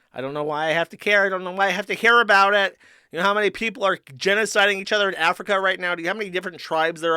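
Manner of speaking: rising into a real angry shout; yelling it out, fed up; heated rant. Real, not acted. Streamer yelling at chat about being made to care.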